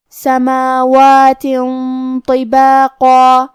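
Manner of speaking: very slow سماوات طباقا with hidden tanween and nasal ghunna; tajweed demo. Shown as a demonstration. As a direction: stretch طباقا with a heavy ghunna. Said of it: "تنوین کو چھپاتے ہوئے اور غنہ کے ساتھ".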